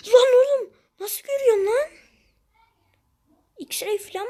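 high, loud yell of disbelief; outraged shout. Real, not acted. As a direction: yell at the opponent, voice leaping up. Outraged at being spotted in game. (öfkeli şaşkınlıkla bağırarak)

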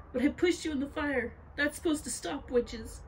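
high-pitched, bewildered objection; distressed protest. A narrator's reading. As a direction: protest in disbelief. Girl protests to the witch.

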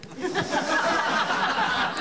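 bursts into a high laugh at her own joke; high cackling laugh. Real, not acted. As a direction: cackle at the punchline.

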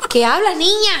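mock disbelief at the niece; incredulous. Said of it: "Yo digo". (incredulidad fingida)

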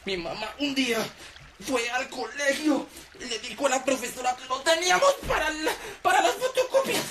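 slow, rising high and moved; boy recalling mom's sacrifice; grateful memory. Retold or imitated. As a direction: thank mom step by step. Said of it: "cada cosa buena era para dar un paso".

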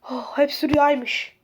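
ordinary delivery

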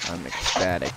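low, soft deadpan sarcasm; dry mock ecstasy; sarcastic. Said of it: "Can you hear it in my voice?".

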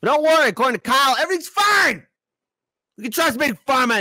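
high, loud, fast sarcastic reassurance; sarcastic shout. Pretend. Host mocking Kyle to viewers, loud high sarcasm. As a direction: yell it with mock cheer. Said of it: "sarcastic mock reassurance".

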